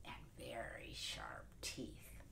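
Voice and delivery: low, slow hushed whisper; creepy storyteller whisper; spooky whisper. A narrator's reading. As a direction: drag it out to scare the kids. Spooky wolf description.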